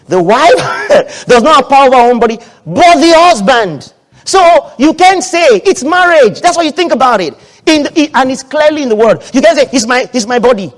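loud, high-pitched sermon proclamation; forceful preaching. Real, not acted. Preacher declaring scripture to the congregation, forceful preaching. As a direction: thunder the verse to the church.

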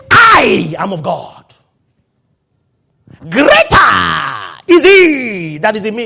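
slow, loud, drawn-out pulpit cry; full-force preaching. Acted. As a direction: preach it out to the whole hall, stretching each word.

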